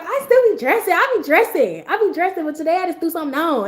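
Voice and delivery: put-on a kid insisting she dresses up; little sister voice. Defending her outfit to her sister, high childish protest.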